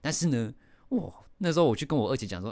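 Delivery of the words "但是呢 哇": ordinary talk, nothing marked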